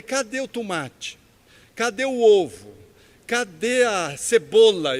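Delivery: slow, loud, chef-like interrogation; retold emphatic demand. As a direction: demand each ingredient like an indignant pizza chef. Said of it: "Eu falei com tanta ênfase". (cobrança enfática, como um pizzaiolo)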